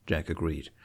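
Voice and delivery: ordinary delivery, nothing marked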